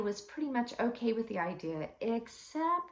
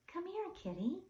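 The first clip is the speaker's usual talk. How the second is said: high, soft call to a cat; soft coaxing; 9 semitones higher, 5 dB quieter than usual. A narrator's reading. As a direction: coax the cat in gently. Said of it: "he said in a soft voice".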